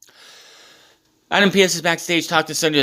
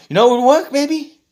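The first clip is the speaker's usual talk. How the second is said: high-pitched teasing suggestion; Daniel Bryan; 14 semitones higher, 4 dB louder than usual. Retold or imitated. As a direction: imitate a wrestler goading his rival. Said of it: "Daniel Bryan is still taunting".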